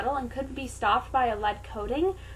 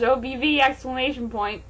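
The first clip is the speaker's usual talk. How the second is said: plain reading voice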